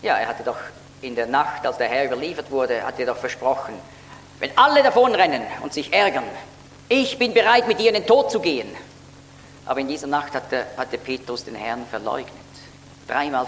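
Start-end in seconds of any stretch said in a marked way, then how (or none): 4.4-8.7 loud, higher-pitched pledge, retold; emphatic vow. Preacher retells Peter's promise to his Lord, emphatic vow.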